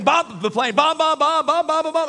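high, loud, frantic repetition; hammed-up panicked shouting; mock yelling. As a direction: holler it over and over in comic desperation. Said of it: "I'm about to start yelling bombs".

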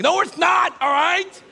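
high, loud mock shout; hammed-up frantic denial; exasperated snap. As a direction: burst out at the kids' reassurance. Snapping back at his kids.

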